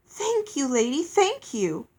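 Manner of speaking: high, bubbling gratitude; joyous. A narrator's reading. Girl thanking the fairy for her blessing, joyous. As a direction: thank her joyfully, pitch leaping up. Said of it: "I smiled joyously".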